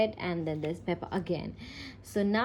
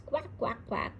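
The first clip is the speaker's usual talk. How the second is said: soft, higher-pitched quacks; storybook quacking; 3 semitones higher, 5 dB quieter than usual. A narrator's reading. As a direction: quack lightly, without really shouting. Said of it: "shouts Richard".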